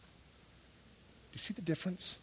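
very soft, breath-only delivery; hushed question. Real, not acted. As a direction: whisper it slowly to the congregation. Hushed question after acted prayer.

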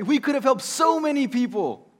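angry Judas. Acted. Judas protesting the wasted perfume, angry. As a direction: scold the waste. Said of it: "He was angry".

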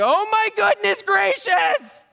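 high, slow, shrill comic panic; panicked shriek. Retold or imitated. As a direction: shriek it in mock terror. Servant sees surrounding army.

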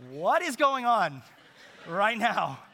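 high, loud, slow cry of amazement; amazed shout; stunned outburst. Retold or imitated. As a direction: belt out disbelief at what's happening. Overwhelmed moment, retold.

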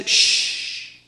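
a soft unvoiced shh; whispered shush. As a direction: hush him gently. Jesus hushes healed leper.

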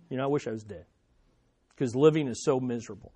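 low, soft acted despair; mock dejection. Preacher voicing a believer who wishes for death, quietly dejected. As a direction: lightly play someone worn down by misery.

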